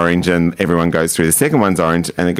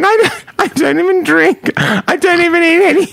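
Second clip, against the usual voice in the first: high, louder mock sobbing plea; imitating crying; 3 dB louder than usual. Retelling a friend pleading with the cop, in mock tears. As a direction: whine it through fake tears. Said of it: "She started to cry".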